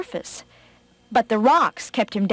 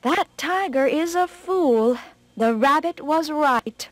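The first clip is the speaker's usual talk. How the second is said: high, slow storybook fox musing; lightly voiced fox, drawing out the thought; fox thinking aloud; 6 semitones higher, slower than usual. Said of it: "the fox thought to himself".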